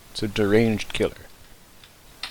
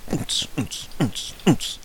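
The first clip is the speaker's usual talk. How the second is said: imitated club beat; 6 semitones higher, 6 dB quieter, slower than usual. Imitating dance party music.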